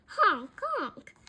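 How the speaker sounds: high, loud honk sound; high-pitched honks; honking horn. A narrator's reading. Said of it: "but then he hears".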